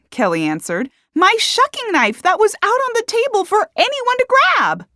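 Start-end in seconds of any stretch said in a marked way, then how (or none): 1.1-4.9 very high, loud protest; indignant defense. A narrator's reading. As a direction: argue back in shrieking exasperation. Accused woman defends herself.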